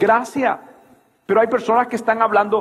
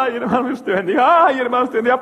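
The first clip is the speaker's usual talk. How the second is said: mock tearful farewell; 5 semitones higher, faster than usual. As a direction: play an overcome brother saying goodbye. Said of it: "nos estábamos despidiendo".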